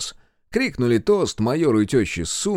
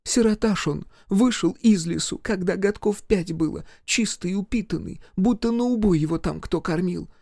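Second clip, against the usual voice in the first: village mother-in-law; 8 semitones higher than usual. Acted. Mother-in-law scolding her husband at the wedding. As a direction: scold the drunk husband, then coax the major.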